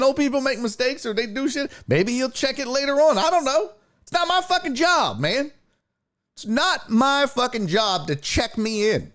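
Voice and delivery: high-pitched indignant retelling; exasperated protest. Retold or imitated. Customer argues check-in with supervisor, exasperated. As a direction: refuse the blame, worked up.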